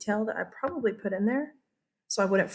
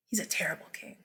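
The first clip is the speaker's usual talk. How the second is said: a conspiratorial whisper; whispered aside; 4 semitones lower, 9 dB quieter, faster than usual. Real, not acted. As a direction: let the class in on a secret. Aside about Richard.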